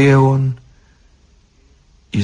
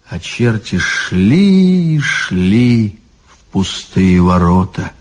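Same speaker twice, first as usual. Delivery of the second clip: ordinary delivery, nothing marked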